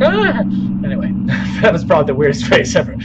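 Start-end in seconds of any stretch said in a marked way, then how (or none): 0.0-0.4 short high mock yelp of fright; mock scream. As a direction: squeak out a fake scared "Ah!". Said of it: "I'm like".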